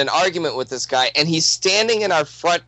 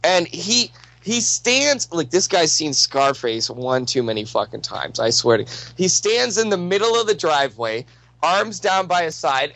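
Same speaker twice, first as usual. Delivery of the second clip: normal talking voice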